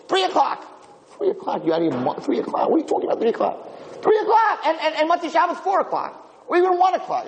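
mock a girl arguing curfew; imitating teenage girls. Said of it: "I have these girls".

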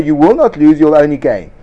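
ordinary talk, nothing marked

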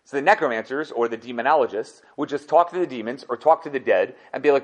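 ordinary delivery

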